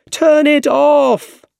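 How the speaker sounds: high, drawn-out urgent shout. A narrator's reading. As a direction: shout the order over the danger, stretching it out. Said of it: "shouted Harry".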